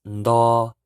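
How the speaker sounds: "ndo" loud with N before D; teacher drilling nr syllable; Hmong nro. Shown as a demonstration. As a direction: clearly say "ndo". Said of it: "N T is pronounced".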